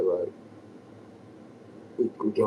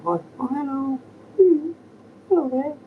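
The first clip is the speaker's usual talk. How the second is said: much higher, sing-song greeting; high bird-talk voice; 9 dB louder than usual. Shown as a demonstration. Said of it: "Like that voice".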